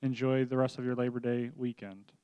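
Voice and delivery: usual speaking voice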